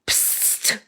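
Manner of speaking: whispered psst of skunk spray; spray hiss. Acted.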